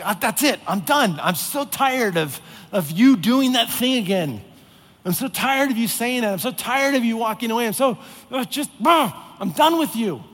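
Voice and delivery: loud, high, exasperated snap of a God at the end of his rope; fed-up outburst. Retold or imitated. An impatient God snapping at his people, fed up and loud. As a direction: blow up like you've had it with them. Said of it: "constantly ready to just be done with us".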